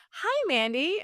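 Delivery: imitating the nurse's chipper voice. Mocking the nurse who suddenly sounds cheerful on the phone. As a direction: lift the voice into a sing-song hello. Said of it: "different, different tone of voice".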